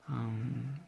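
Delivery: usual speaking voice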